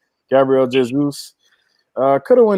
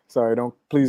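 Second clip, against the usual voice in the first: usual speaking voice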